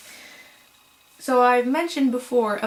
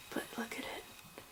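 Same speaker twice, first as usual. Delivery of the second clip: soft breathy whisper; 20 dB quieter than usual. Real, not acted. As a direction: hush it to a whisper. Whispering about yarn shimmer.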